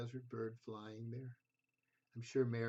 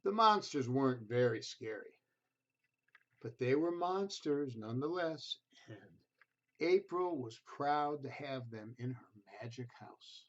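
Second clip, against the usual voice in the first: gentle storybook reading voice; reading to children; 2 semitones higher, 4 dB louder than usual. A narrator's reading. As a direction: read it like a bedtime picture book. Reads picture book to children.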